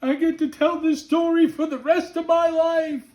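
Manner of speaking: high, squeaky mock laughing-crying voice; imitating laughing till crying. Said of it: "I'm laughing so hard I'm crying".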